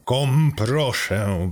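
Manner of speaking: slow, drawn-out restrained plea; hissed through teeth. A narrator's reading. Driver begs his car computer to stop, hissed through teeth. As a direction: stretch it out through gritted teeth, mildly irritated. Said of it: "wysyczałem przez zęby".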